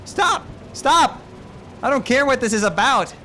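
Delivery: high loud shouting; yelling stop. Acted. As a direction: shout them apart. Yelling at fighting teammates.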